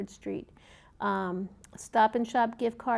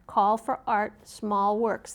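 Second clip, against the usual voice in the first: ordinary delivery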